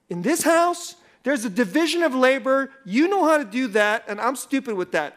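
hammed-up righteous indignation. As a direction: lay down the law, self-righteous. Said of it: "now here's how I felt".

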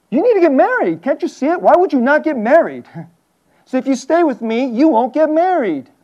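high-pitched, exasperated pleading; insistent urging. Acted. Naomi to her daughters-in-law about remarrying, urgent high-pitched pleading.

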